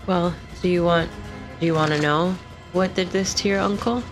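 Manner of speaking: ordinary talk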